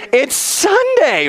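very high, drawn-out exclamation; exaggerated high-pitched cry; excited blurt. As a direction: sing out the answer, stretched and high. Acts out blurted answer to the question.

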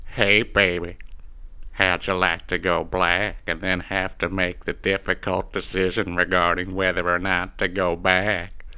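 low, slow, soft cartoon smooth-talker; Cleveland Brown. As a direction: drop low and croon it unhurriedly. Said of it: "you would say things like".

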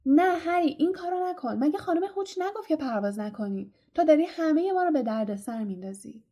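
high-pitched urgent protest, settling towards the end; crying out. A narrator's reading. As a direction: cry out to stop him, then scold. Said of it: "هرمیون گرنجر فریاد زد".

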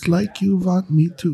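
slow, high mock-German singing; German accent. Singing the song for a friend, mock German accent. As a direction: sing it slowly with a thick fake German accent. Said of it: "in your best German accent".

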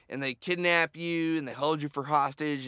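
ordinary talk

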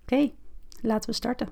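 calm guided meditation, unhurried pauses. Acted.

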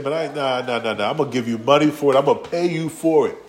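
normal talking voice

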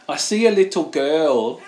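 put-on slow, drawn-out little boy voice; creepy child, slowly stretched; son's creepy line. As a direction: imitate a small child, drawing out each word. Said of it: "You know what he said?".

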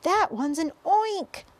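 high, loud, drawn-out cry; screaming. A narrator's reading. Head of fire department sees second creature, alarmed scream. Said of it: "screamed the head of the fire department".

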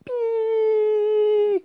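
long high steady beep; imitated tinnitus ringing. Crash victim showing the ringing in their ears, imitated high beep. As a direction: hold a piercing "piii". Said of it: "comme ça, super fort, comme, bah, un acouphène".

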